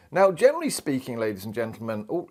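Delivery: usual speaking voice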